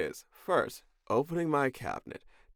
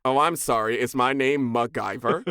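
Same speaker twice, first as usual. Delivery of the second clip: loud, sarcastic fake apology and questions; loudly affronted, playing it up; mock indignation; 3 semitones higher, 7 dB louder than usual. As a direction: act offended, hammering each sarcastic question. Mock-offended retort to MacGyver comparison.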